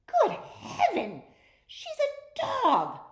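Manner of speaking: shocked queen. A narrator's reading. Queen shrieks as a dog eats from her plate. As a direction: shriek it in royal horror.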